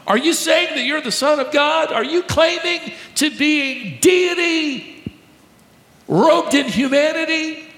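high shouted questions, slowing on deity; outraged accusation. Acted. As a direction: accuse him of blasphemy at full voice. Preacher voices outraged Sanhedrin.

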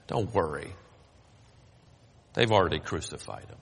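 low, quiet deadpan; dry voice from the back. Retold or imitated. A stranger in the lift, quiet deadpan. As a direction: drop it flat and low like a dry aside. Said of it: "came a single voice that said".

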